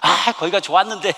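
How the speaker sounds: playful imitation of a child's protest; grumbling son. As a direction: voice a boy regretting he came back, half laughing. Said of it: "아들이 그러는데".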